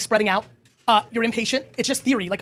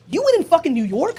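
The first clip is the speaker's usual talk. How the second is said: excited build; 7 semitones higher, 3 dB louder than usual. Real, not acted. Hyped prediction about KD.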